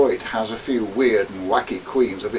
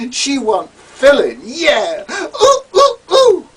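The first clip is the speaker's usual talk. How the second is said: screaming queen yelling; 11 semitones higher, 8 dB louder, slower than usual. Acted.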